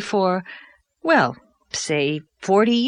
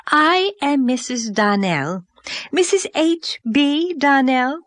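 higher, slow and self-important; pompous society lady, pitched higher; Mrs Donnell; 5 semitones higher than usual. Acted. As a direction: announce yourself like a grand overdressed lady. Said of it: "announced this vision".

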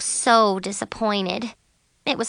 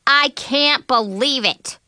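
slow, higher-pitched disbelief; exclaiming; 5 semitones higher, 4 dB louder, slower than usual. A narrator's reading. Friend reacting to the disqualification, exclaiming in disbelief. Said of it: "Violet exclaimed".

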